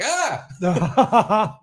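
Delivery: high laughing mock shriek; retelling Caitlin's spider scare; mock scream. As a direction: toss off a playful fake shriek. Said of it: "again, like".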